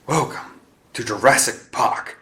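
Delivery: hammed-up English accent, a mild yell; a kid doing a poor English accent; bad English accent. Biggs imitating John Hammond to his team in a bad English accent. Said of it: "Biggs yelled in a bad English accent".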